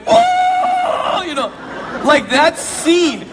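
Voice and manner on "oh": hammed-up groan on the toilet; retold groan of relief. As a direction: let out a big high groan. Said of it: "just like".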